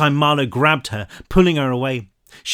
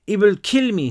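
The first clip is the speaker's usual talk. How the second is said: put-on high, distressed French-accented girl; frightened French child, pitched high; broken English, French accent; 8 semitones higher, 4 dB louder than usual. A narrator's reading. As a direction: stumble through French-accented English, pleading. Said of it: "she said in broken English".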